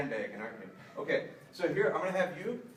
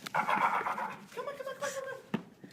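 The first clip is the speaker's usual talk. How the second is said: high coaxing; slower than usual. Real, not acted. Trainer coaxing the puppy in a high voice. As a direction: coax her gently upward in pitch.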